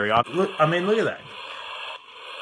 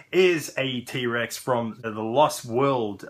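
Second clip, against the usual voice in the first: ordinary delivery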